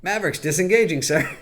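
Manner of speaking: high, clipped radio call; pilot radio call. Retold or imitated. Comic Tom Cruise fleeing a Scientology mission. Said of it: "Tom Cruise is like".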